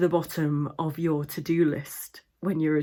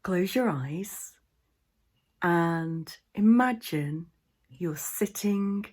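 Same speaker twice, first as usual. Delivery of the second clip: guided meditation. Acted. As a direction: guide listeners into the imagined scene, unhurried.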